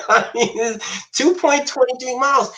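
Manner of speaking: laughing. Real, not acted. Still laughing at the joke.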